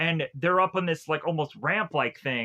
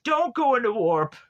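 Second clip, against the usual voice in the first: higher mock yell; pitched-up urgent objection; mock alarmed warning; 6 semitones higher, 4 dB louder than usual. Partner reacting to the driver's antics, mock alarmed warning. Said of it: "And Mac is like".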